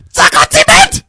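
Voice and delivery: high, loud German shout; mom's German yell. Retold or imitated. Son recalls mom's German outburst before a smack, loud. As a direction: bellow it like an angry German mom. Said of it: "she always used to say".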